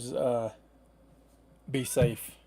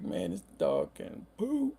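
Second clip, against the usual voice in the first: lower, slow, put-on voice of disbelief; amazed inner voice; 6 semitones lower, 5 dB quieter, slower than usual. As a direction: drop into a slow mock-stunned voice. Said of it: "I was like".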